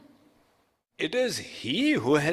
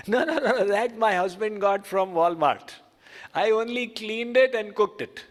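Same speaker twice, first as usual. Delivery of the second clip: lady protesting; 7 semitones higher than usual. Retold or imitated. Lady explains to the swami.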